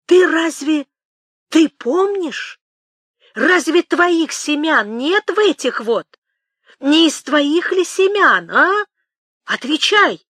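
high, loud, frantic questioning; stunned demand. Acted. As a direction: press for an answer, dazed and insistent. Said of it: "ошалело спросил Темляков".

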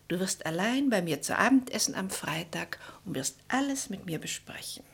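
secretive whisper. Acted. Julia privately invites Edward to dinner, whispering. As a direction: breathe the invitation just for him.

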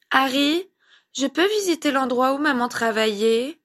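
put-on high-pitched, tentative child's question; timid little boy. A narrator's reading. Little boy asks his godfather for a visit, timidly. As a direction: ask shyly in a small child's voice. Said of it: "demanda timidement le petit garçon".

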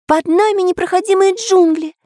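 very high-pitched outcry; exclamation. A narrator's reading. Karandash looks down at the island, high-pitched exclamation. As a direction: call out the sighting in a high little voice. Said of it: "воскликнул Карандаш".

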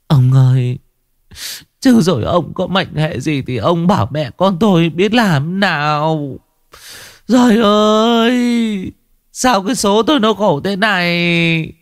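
high, stretched-out wailing lament; an old wife wailing over her husband's fate. Acted. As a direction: cry out to heaven in despair. Wife wails in despair.

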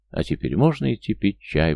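plain reading voice

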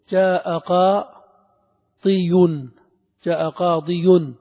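drawn-out qadiyun, ending -iyun sounded on "قاضي"; heavy full ending. Shown as a demonstration. Teacher demonstrating the heavy word, qadiyun drawn out in full. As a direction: stretch "قاضي" slowly into qaa-dii-yun. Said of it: "تخلص من الثقل".

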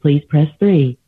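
phone menu voice. Real, not acted. Recorded company phone menu.

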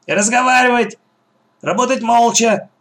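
high-pitched bossy bark; barked order. A narrator's reading. Gang boss silences the digging children, barking. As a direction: bark it as a sharp reprimand. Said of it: "рявкнула Черногорская".

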